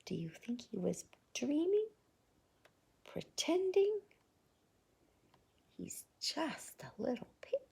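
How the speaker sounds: soft fast whisper. Acted. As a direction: lean in and whisper to a child. Hushed question to listener.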